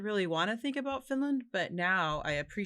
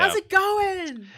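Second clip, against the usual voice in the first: high, loud and slow, hammed-up friendly; overeager American small talk; 13 semitones higher, 10 dB louder, slower than usual. Mocking Americans meeting abroad, slow loud gushing chatter. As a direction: stretch each word like a delighted tourist. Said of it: "if you see other Americans in Europe".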